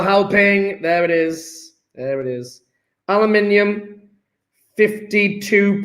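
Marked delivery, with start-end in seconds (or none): 1.9-2.6 soft, low repeat; low echo. Real, not acted. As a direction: echo it under your breath, pitched down. Lower echo of same words.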